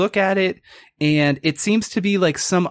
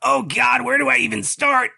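high, wailing creature voice; despairing lizard person; 10 semitones higher than usual. Acted. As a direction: wail your woes in a high monster voice. Lizard person laments his troubles.

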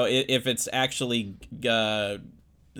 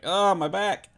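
higher-pitched mock groan of pain; aching-back complaint; 9 semitones higher, 4 dB louder, slower than usual. Tux wearer complains each night, mock aching. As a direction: act out a sore back, high and drawn out.

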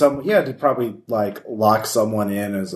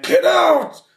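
very high, loud ghost shriek; ghostly shout; 5 dB louder than usual. Acted. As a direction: shriek it like an angry spirit. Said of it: "you just hear it go".

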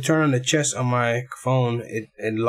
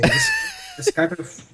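pitched way up like a chipmunk; slower than usual. Real, not acted. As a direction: voice changer squeak. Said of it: "you sound like a chipmunk".

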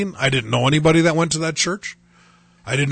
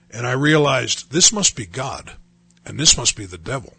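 ordinary talk

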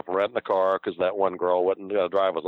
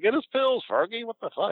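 high, panicky mock yell; lightly hammed-up frantic Eddie; Eddie alarmed. Eddie yelling to Fergie about the pills, high and panicked. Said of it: "And he was like".

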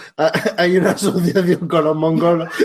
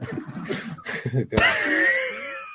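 squealing laugh; 4 semitones lower, 8 dB quieter than usual. Real, not acted. Helpless laughter after story.

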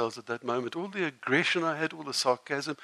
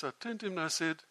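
ordinary delivery

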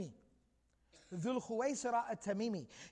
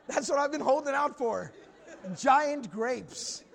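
loud, hammed-up comic triumph; mock delighted outburst; 3 semitones higher, 8 dB louder, slower than usual. Bedouin hears of grapes in paradise, comically thrilled. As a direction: burst out as if finally won over.

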